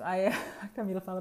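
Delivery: ordinary talk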